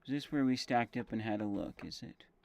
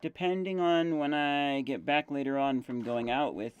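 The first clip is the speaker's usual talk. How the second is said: talking while chewing gum. Real, not acted. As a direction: gum in the mouth as you talk. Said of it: "if you hear chewing noises".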